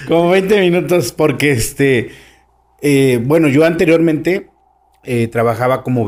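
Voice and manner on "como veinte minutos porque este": higher, amused repeat through laughter; punchline repeated with a laugh. Real, not acted. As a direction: say it while still laughing.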